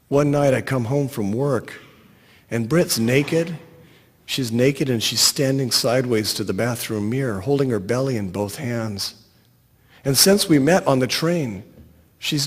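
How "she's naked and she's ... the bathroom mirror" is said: plain reading voice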